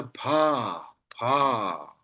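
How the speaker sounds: "pa" with a p and long open a. Shown as a demonstration. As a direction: say "pa" drawn out, falling. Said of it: "Usually we read this as a".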